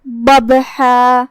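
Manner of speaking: ببحا said very slowly, with the ba bounced as a qalqala echo; qalqala demo. Shown as a demonstration. Teacher shows students the qalqala on ba, very slowly. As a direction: make the ba in ببحا jump with a small echo, drawn out slowly. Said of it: "ہم با کو ہلاتے ہوئے یہ کلمہ کو ادا کریں گے".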